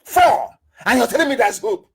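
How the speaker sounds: high, loud one-word burst; outraged shout. Real, not acted. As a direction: shout it in disbelief. Outraged repeat of the number.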